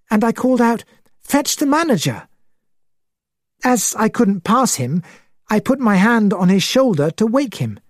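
high-voiced witness recounting a shock; excitable gentleman, high voice throughout; excited, high. A narrator's reading. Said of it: "He spoke in an excited, high voice".

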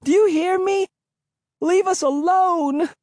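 put-on a girl screaming at her pursuer to stop; high, frightened plea; desperate cry. A narrator's reading. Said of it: "Her pleas".

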